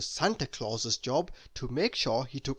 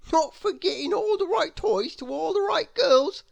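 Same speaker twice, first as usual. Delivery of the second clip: much higher chirpy snowman voice; snowman reply; 12 semitones higher, 5 dB louder than usual. A narrator's reading. Mister Snow correcting Santa: high cheeky snowman voice. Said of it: "replied Mister Snow".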